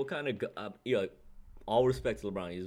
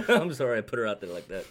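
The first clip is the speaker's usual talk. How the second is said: laughing apology; 8 dB louder than usual. Real, not acted. Laughing apology for bad suggestion.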